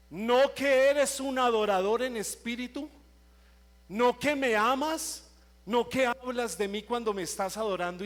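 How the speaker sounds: thundering divine rebuke; God's rebuke. Retold or imitated. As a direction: voice God scolding the proud worshipper. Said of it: "Ese día el Señor a mí me habló".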